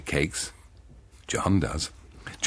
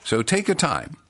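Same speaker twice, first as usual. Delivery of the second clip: higher, louder wife's voice; Janet; 9 semitones higher, 4 dB louder than usual. Acted. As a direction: play the wife giving orders, raised and bright. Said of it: "Janet says".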